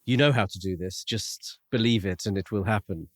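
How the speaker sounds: usual speaking voice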